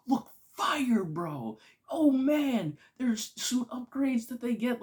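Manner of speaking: high, loud fan rave; gushing excitement. Real, not acted. Fan raving about the suit upgrades, gushing. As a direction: burst out with high-pitched delight.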